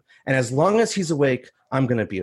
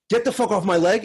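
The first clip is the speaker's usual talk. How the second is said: higher-pitched theatrical tough-guy line; hammy high school musical tough guy; drama club tough guy; 6 semitones higher than usual. Shown as a demonstration. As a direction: show off the stage character. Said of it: "It's the same guy".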